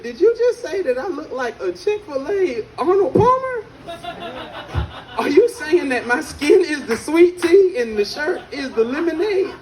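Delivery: high-pitched, affronted questioning; indignant disbelief. Retold or imitated. Brother questions his sister's roast, high incredulous indignation. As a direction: act out offended disbelief, voice climbing high.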